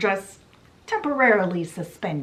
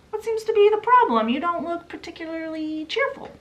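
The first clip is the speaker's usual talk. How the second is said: much higher, cheery working-man voice; chirpy engine driver; 8 semitones higher, faster than usual. Acted. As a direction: greet her brightly, pitched way up. Engine driver asks what's wrong.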